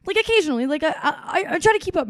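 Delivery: high, flustered backpedal; imitated flustered girl; flustered fan. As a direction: scramble to take it back.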